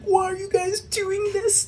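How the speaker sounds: high pleading whine; guy in distress. Retold or imitated. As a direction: beg in a squeaky panic. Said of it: "he was just like".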